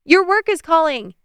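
put-on high, loud girl's voice; child calling out. A narrator's reading. Daughter yelling that dad's phone rings, high and loud. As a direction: shout it to dad across the yard.

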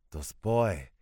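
put-on loud, contemptuous old woman's voice; scornful grandmother. A narrator's reading. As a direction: spit out the words with disdain.

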